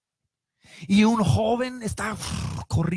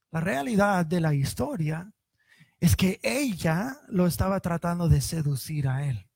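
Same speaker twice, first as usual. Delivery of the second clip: ordinary talk, nothing marked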